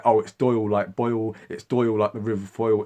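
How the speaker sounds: put-on Doyle rhymed with Boyle and Foyle; corrected pronunciation Doyle. Commenters explaining by rhyme, Doyle with "oy". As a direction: stress the "oy" in Doyle. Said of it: "different variations on how to pronounce".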